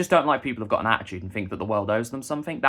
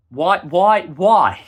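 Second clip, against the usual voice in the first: louder, higher rising why; exasperated shout; 4 semitones higher, 9 dB louder, much slower than usual. Real, not acted. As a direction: burst out in exasperation. Ranting, exasperated outburst.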